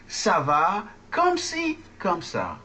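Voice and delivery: slow, word-by-word French, high on "ci"; slow model. Shown as a demonstration. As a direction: spell out the phrase for learners to repeat. Teacher models slowly for repeating.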